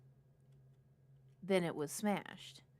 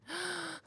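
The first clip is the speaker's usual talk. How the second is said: sharp, high gasp of fright; sudden startled intake of breath; scared gasp; 10 semitones higher, 7 dB quieter than usual. Real, not acted. Streamer jumps at the game, frightened gasp. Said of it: "That scared me so bad".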